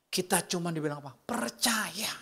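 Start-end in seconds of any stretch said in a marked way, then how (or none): 1.1-2.1 whisper. Acted. Preacher answers his own question.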